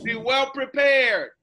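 slow, loud chant; call-out shout. Shown as a demonstration. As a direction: shout it for kids to echo. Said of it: "continue to repeat after me".

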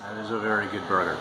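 talking while chewing food; muffled speech around bite; mouth full, chewing. Real, not acted. Seen 4: Bites burger at -4, chews and talks throughout, bites again.

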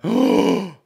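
loud, high shocked gasp; exaggerated gasp of recognition; mock gasp. Girl suddenly recognising someone famous, hammed-up gasp.

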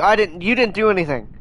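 ordinary talk

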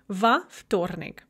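"в" said as a full "va" before "вторник"; slow "va ftornik" for "в вторник"; extra vowel after в. Shown as a demonstration. Teacher showing learners the preposition before a cluster, "в" voiced as "va". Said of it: "starts with v plus another consonant".

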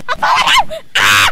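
high rising scream; panicking gamer. Real, not acted. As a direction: yell it again, still panicked. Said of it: "Sorry if I'm losing my voice".

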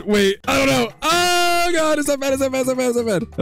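high, strained panicked cry; sudden alarm, voice jumping up. Real, not acted. Gamer panicking over a bad trade, high and alarmed.

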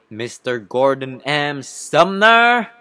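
grand courtroom unmasking; dramatic reveal. Acted. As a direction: draw it out, then pounce on the last word. Lawyer reveals witness's real name.

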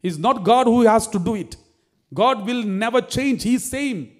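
fiery preacher pressing his point; full-force preaching. Acted. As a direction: preach it out to the congregation.

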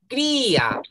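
"Cría" with a high, held Í; emphatic CRÍ-a for "Cría"; forceful I. Shown as a demonstration. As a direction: push and stretch the Í of "Cría", then drop on A. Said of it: "le va a dar fuerza".

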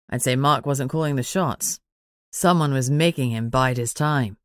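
lightly voiced teasing man; Manners, teasing. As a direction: tease her, pitched low and easy.

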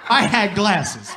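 usual speaking voice

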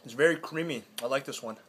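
chewing while talking; talking between bites; mouth full of pizza. Real, not acted. Seen 2: Bites pizza and chews with full cheeks while speaking.

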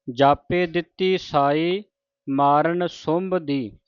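measured, chanted reading of sacred verse; scripture recitation. Shown as a demonstration. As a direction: recite each line deliberately, with pauses.

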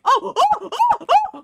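high, loud repeated yelps; startled screams. Real, not acted. Player startled by the game's monster, screaming high. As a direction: yelp over and over in alarm. Monster appears in horror game.

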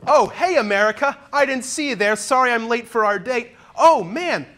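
high, loud hammed-up host voice; hammy surprised host. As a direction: play a startled cheesy host. Comic skit caught with America.

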